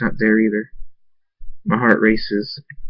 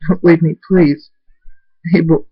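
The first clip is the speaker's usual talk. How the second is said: higher, louder tearful begging; crying plea; 7 semitones higher, 4 dB louder than usual. A narrator's reading. Father fallen to his knees calling Abel, crying plea. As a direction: plead as you cry.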